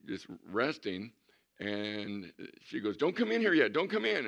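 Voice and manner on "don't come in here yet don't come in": high, louder mock-frantic warning; urgent call to stop. Mom keeping the doctor out, urgent call. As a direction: call it out high to halt someone at the door.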